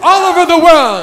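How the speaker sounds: high, loud proclamation; rousing preaching. Real, not acted. Preacher proclaiming thundering voices all over the world, shouted. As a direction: ring it out across the hall.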